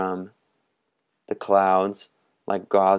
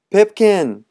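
much higher, loud group shout; 12 semitones higher, 10 dB louder than usual. Acted. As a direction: boys shouting from the cliff. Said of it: "everyone shouted".

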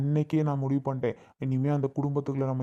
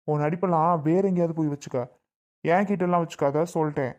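ordinary talk

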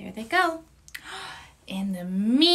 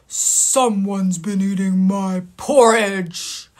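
lower, slow, heavy papa bear voice; gruff Papa Bear; 4 semitones lower, 4 dB louder, slower than usual. Acted. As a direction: play the big stern father bear. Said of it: "Papa Bear looked at his bowl and said".

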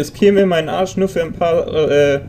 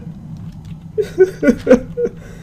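high breathy nervous giggle; nervous high laugh. Real, not acted. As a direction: laugh off the fear.

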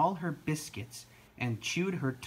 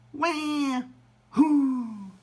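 high wail, then loud hoot; two seal calls; 12 semitones higher, 5 dB louder than usual. A narrator's reading. Reunited seals calling, wailing and hooting. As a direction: cry as baby, hoot as mother. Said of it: "said the seals".

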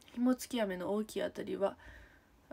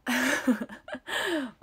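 high, louder burst of laughter; helpless laugh; 5 semitones higher, 4 dB louder than usual. Real, not acted. Streamer embarrassed by the bread visit, helpless laugh. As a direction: crack up, high-pitched.

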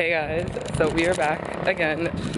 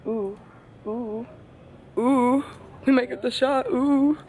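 rising, hopeful oohs as someone comes closer; building excitement, voice climbing; excited ooh; 7 semitones higher than usual. Real, not acted. Friends waiting for a photographer, eager rising oohs. Hoping a passerby takes photo.

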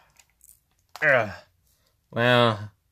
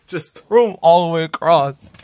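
laughing, louder; 6 semitones higher, 8 dB louder than usual. Real, not acted. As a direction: laugh through the words. Laughing at a wild game throw.

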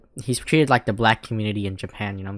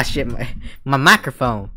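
ordinary talk

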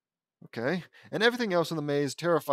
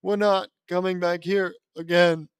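nauseous; 4 semitones higher, 7 dB louder than usual. Acted.

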